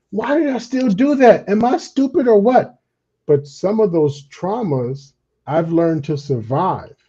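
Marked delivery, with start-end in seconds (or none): 0.1-2.8 high-pitched incredulous self-scolding; acted frustrated wail at yourself; exasperated self-reproach. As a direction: question yourself in disbelief. Acted self-reproach over red flags.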